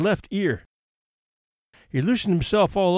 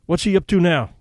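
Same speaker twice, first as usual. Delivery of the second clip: ordinary delivery, nothing marked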